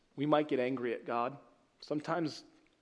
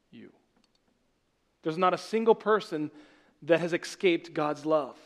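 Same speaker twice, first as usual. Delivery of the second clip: ordinary delivery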